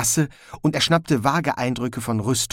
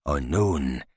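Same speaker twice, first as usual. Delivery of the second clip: Lord Hong, menacing; 6 semitones lower, slower than usual. A narrator's reading.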